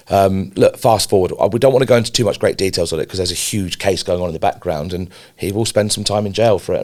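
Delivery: ordinary talk, nothing marked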